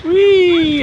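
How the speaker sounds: high, loud cheer; excited wee. Real, not acted. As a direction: cheer like on a ride. Watching jumps.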